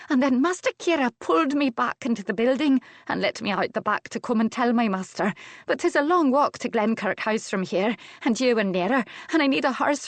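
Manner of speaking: high Scots maid pouring out her story; breathless rush. A narrator's reading. Maid reports the abduction to the master, breathlessly. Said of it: "stopped as if to catch her breath".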